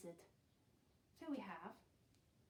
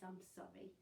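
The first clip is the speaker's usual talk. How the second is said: usual speaking voice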